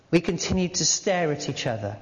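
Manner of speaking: ordinary talk, nothing marked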